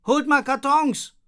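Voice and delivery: high, loud, slow call. Acted. Boy on the bin shouts to the girls for boxes. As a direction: shout down for help.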